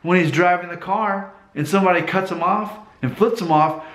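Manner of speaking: normal talking voice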